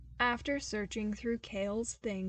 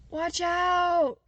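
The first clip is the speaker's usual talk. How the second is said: warning shout; 8 semitones higher, 11 dB louder than usual. Acted. Someone shouts warning at Cale.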